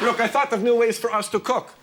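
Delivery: ordinary delivery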